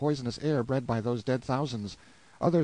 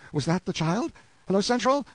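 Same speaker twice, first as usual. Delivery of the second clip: raised, high-pitched eager questions; delirious calling out; 7 semitones higher, 4 dB louder than usual. A narrator's reading. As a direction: call hopefully to someone out of reach. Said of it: "talking brokenly but with spirit".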